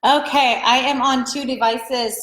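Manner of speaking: ordinary talk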